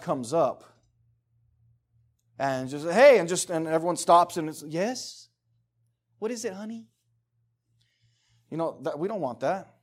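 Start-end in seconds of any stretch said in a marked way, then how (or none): 2.9-3.2 a mock loud call of hey; a sudden kid's call to grab attention; child's interrupting call. 4.7-6.9 put-on softer, doting reply to the child; a hint of an overly sweet parent; mock sweet parent.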